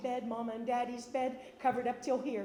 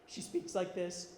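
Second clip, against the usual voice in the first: low, slow imitation of a deep-voiced sister; 4 semitones lower, slower than usual. Storyteller showing the audience how her sister speaks, deep voice. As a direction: drop down low and draw it out. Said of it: "She has a really deep voice".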